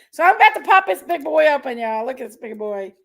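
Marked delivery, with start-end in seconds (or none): none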